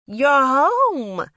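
high, loud, thrilled cry; loud teenage delight; excited greeting. A narrator's reading. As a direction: shout it like an overjoyed kid sister. Sister leaps into her arms.

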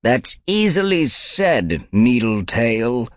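high, slow rasp; elder she-cat rebuking; rasping old she-cat. A narrator's reading. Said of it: "The rasping voice came from an old gray she-cat".

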